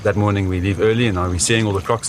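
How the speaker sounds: ordinary talk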